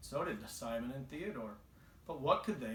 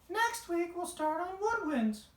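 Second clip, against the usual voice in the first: much higher cheerful teacher voice; sing-song high teacher announcing; teacher's voice; 4 dB louder than usual. Acted. Mother reading the teacher's line, high and bright. Narrator voices Missus Melody.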